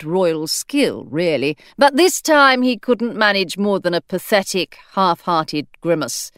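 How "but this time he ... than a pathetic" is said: ordinary delivery, nothing marked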